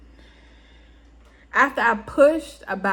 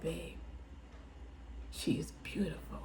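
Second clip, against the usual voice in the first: soft, hushed whisper to his partner; 7 semitones lower, 20 dB quieter than usual. Retold or imitated. Husband whispers to the new mother about their baby. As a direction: breathe it out in awe. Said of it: "And it was like".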